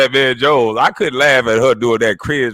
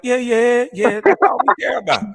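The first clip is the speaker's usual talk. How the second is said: higher mock voice of the crowd, parroting them; 8 semitones higher than usual. Mocking the race-obsessed crowd with a higher parody voice.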